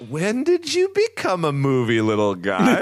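high, incredulous question; puzzled, rising disbelief; baffled surprise. Retold or imitated. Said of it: "it was like".

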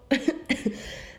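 breathless unvoiced cough; choking cough. Real, not acted. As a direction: cough from swallowing wrong way. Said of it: "Ich hab mich verschluckt".

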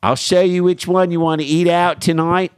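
high, loud hammed-up Philly come-on; Philly accent. Host riffing on Philly Zoom porn, in a hammed-up Philly accent. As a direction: lay on a thick Philly accent.

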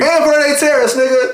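high, fired-up insistence; emphatic urging. Real, not acted. Fan adding to the list friends must hear, emphatic and high.

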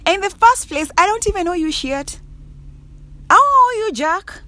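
high-pitched, incredulous indignation; indignant telling-off. Acted. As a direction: tell off a nosy acquaintance, pitch shooting up. Acted telling-off of nosy acquaintance.

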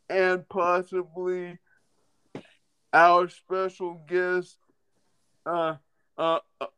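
ordinary talk

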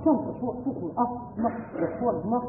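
low, hushed coaxing; very soft, placating murmur; soothing apology. Acted. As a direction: give in softly to calm someone crying. Husband soothes his crying wife.

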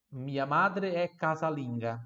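slow, clear teacher reading; slow model sentence. Shown as a demonstration. Teacher modelling for repetition, slowly. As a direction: say it slowly for the class to copy.

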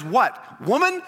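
"What? Woman?" with a sharp questioning rise; preacher mocks Jesus calling her a curt "Woman?"; dismissive "Woman?". Preacher shows the wrong way Jesus might speak, a scornful "Woman?". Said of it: "No, he looks at her and he says".

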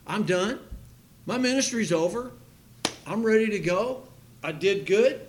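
a light voicing of a joyful, ready Paul. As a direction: sound glad to be finished. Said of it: "So he was saying".

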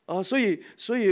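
ordinary delivery

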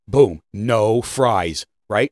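ordinary talk, nothing marked

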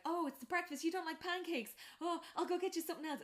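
a gentle giant fussing over breakfast; eager host. Retold or imitated. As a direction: hurry to please her.

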